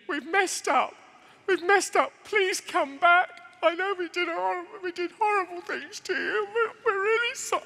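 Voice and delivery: high, wailing mock plea; desperate whining apology, badly overacted; begging. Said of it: "begging you".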